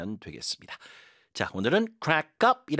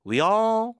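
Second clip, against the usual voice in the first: all said much higher and louder, stretched long; stressed, drawn-out all; 11 semitones higher, 6 dB louder than usual. Shown as a demonstration. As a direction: lean on all and draw it out. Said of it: "all이 나오면 여기 힘을 주면서 끌어주세요".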